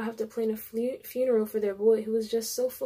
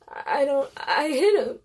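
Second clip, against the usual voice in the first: broken confession; 4 semitones higher, 6 dB louder than usual. A narrator's reading. Confessing, breaking down.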